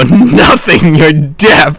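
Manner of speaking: imitated shouted punchline; retold scream. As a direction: yell it at him, held back a little. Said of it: "I just scream this at him".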